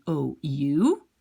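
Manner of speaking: ordinary delivery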